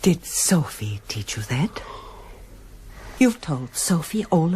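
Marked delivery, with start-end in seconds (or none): 0.0-1.8 hushed whisper. Acted. Mother bitter with son.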